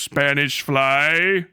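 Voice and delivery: slow, drawn-out Cosby delivery of Spanish fly; Bill Cosby. Retold or imitated. Streamer quoting Cosby's old routine, slow drawl. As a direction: stretch it out like an old comic. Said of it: "where he goes".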